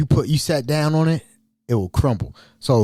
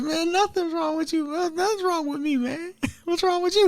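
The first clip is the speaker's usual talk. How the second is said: much higher, softer mock denial; squeaky nervous denial; 5 dB quieter than usual. Mike denying anything is wrong to Mel, squeaky nervous voice. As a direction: deny it too fast and too high.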